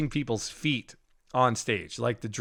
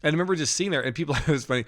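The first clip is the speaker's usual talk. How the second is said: usual speaking voice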